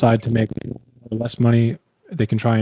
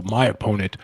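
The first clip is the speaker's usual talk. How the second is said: attack ad voice; 3 semitones lower, slower than usual. Retold or imitated. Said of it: "run ads that go".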